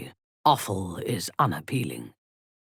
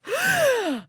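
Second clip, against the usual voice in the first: high startled gasp; sudden gasp of surprise; sharp gasp. Acted. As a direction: gasp in shock.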